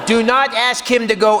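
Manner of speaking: normal talking voice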